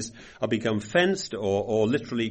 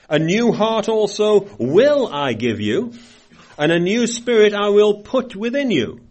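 scripture reading; 3 semitones higher, 5 dB louder than usual. Acted. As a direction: lift the promise up like a proclamation. Reads scripture aloud.

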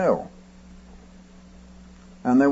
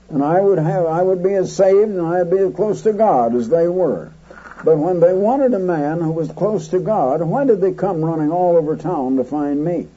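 usual speaking voice

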